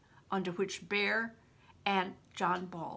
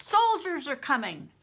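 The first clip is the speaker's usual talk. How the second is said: loud, high alarm; urgent warning; 7 semitones higher, 7 dB louder than usual. Acted. Warning shout to the room.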